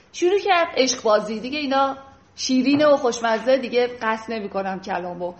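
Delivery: ordinary talk, nothing marked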